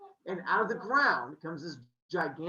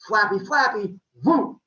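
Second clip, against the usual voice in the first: mock flapping; 3 semitones higher, 11 dB louder than usual. Zeus flapping swan wings.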